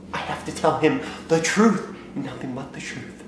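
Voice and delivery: usual speaking voice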